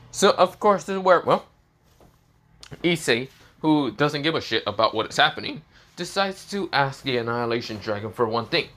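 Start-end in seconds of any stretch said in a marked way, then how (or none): none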